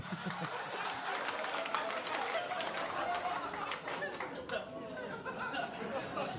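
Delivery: airy giggle up high; wheezing laugh at his own joke; high breathy laugh. Real, not acted. Comedian cracking up at his own joke, airy laugh.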